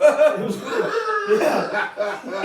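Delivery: high, loud laughter; laughing. Real, not acted. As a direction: laugh it out helplessly. Laughing hard.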